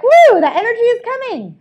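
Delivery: energized cheer. Shown as a demonstration. Coach cheering the class as new energy surges, excited whoop. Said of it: "it's like".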